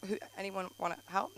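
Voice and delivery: normal talking voice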